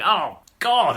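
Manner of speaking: exasperated mock outburst; angry. Juvenal opening his friend's poem, mock angry. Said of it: "getting even more angry".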